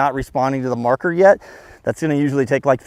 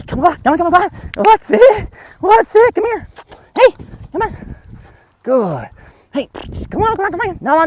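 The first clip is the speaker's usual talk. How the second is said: very high, rapid-fire coaxing easing into louder praise; excited play voice; 13 semitones higher, 5 dB louder than usual. Shown as a demonstration. Trainer playing tag with the dog, excited high voice. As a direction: egg the dog on in a squeaky voice. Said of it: "pet at her and go".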